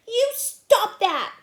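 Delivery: loud, high, slow protest; angry. Acted. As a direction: stamp it out at the teasers. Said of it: "she said angrily".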